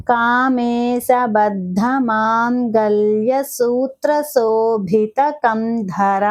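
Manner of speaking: the whole name drawn out, ధర lengthened at the end; slow careful recitation. Shown as a demonstration. Teacher modelling the full name for students, slow. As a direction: recite it slowly, stretching the last syllable. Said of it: "slow recitation demo".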